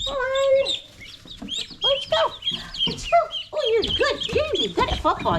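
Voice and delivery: high-pitched cheering at ducklings; excited pet talk. Real, not acted. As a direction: praise the ducks like a proud parent. Cheering ducks into pen.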